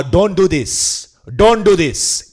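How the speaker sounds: open, slow Bengali accent; broad open Bengali vowels. Shown as a demonstration. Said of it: "My Bengali accent is more like, it's more open".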